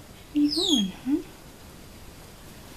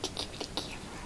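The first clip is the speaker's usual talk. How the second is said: whispering; 14 dB quieter, faster than usual. Real, not acted. Whispered plea to pet.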